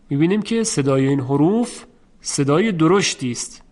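normal talking voice

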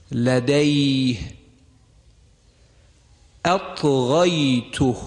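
slow clear Quranic words, each said separately; model recitation. Shown as a demonstration. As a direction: recite each word slowly with a pure fatha before و and ی. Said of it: "توجه بفرمایید".

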